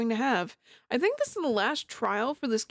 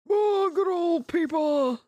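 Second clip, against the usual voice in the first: Peepaw impression; 6 semitones higher, slower than usual. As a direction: do your grandpa's old voice. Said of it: "I'm getting better at the Peepaw impression".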